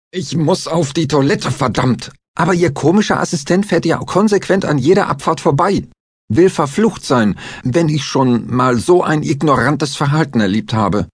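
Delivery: high, heated ranting grumble; angry complaint. A narrator's reading. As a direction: rant at them, fully worked up. Angry passenger complains loudly.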